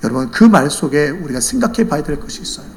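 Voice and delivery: ordinary talk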